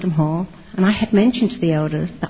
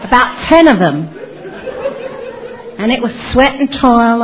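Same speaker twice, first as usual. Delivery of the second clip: laughing; 5 semitones higher than usual. Real, not acted. Laughs at her own boast.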